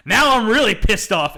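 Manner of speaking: loud, high mock-furious villain line; gravelly anger. Host quoting the staked villain's first line, angry and loud. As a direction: announce the fury with a heavy first word. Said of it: "gravelly, gr-, like, more gravel-ish sounding".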